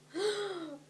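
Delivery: a big theatrical intake of breath; loud high gasp. Real, not acted. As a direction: gasp in mock horror. Reacting to own reading.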